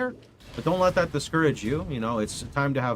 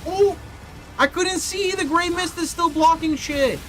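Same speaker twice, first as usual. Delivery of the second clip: exasperated complaining; 13 semitones higher than usual. Real, not acted. Bosses won't die, complaining.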